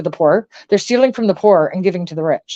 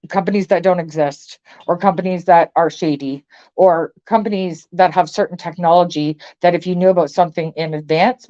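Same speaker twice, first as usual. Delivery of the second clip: normal talking voice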